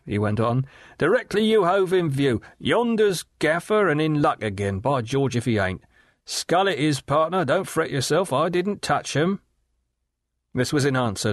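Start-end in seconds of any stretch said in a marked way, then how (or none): 1.0-9.4 high, sly leering call across the water; a higher, knowing river rat's voice; leering waterman. Acted. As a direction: Riderhood hailing Gaffer with a sly leer, pitched high. Riderhood's voice held.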